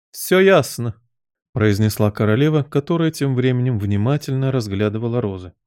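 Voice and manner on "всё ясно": high, abrupt interruption; imperious Queen. A narrator's reading. Queen interrupts the gardener, imperiously. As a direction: cut him off as the Queen.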